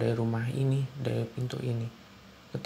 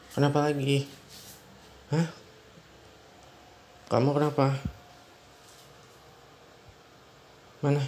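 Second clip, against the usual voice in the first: worried questions; 3 semitones higher than usual. Acted. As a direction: press her urgently about the pain. Husband alarmed wife in pain.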